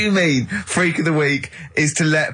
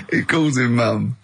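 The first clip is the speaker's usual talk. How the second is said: usual speaking voice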